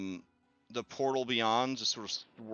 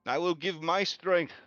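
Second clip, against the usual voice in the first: higher, resolute sacrifice speech; raised heroic oath; solemn vow; 6 semitones higher than usual. Acted. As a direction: give a noble farewell. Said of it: "Conway looks over at Orin and says".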